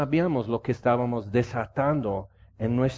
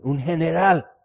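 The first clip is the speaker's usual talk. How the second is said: "Un general" thrown out higher and louder; exclaimed in awe; 4 semitones higher, 5 dB louder than usual. Real, not acted. Preacher marveling to the congregation at the general, excited exclaim. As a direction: marvel at the rank. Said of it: "He estado esperando este día".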